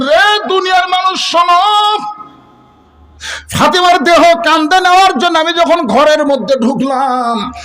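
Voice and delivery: very high, ringing preacher's cry; declaimed call. Retold or imitated. Preacher voicing Ali's grief story to the world, declaimed call. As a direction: call out to all mankind, pitched high. Preacher voices Ali calling mankind.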